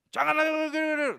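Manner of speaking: beaucoup plus aigu et plus fort, insistant; répétition hautaine. Retold or imitated. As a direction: marteler le mot avec suffisance. Pria berpamitan dengan sombong.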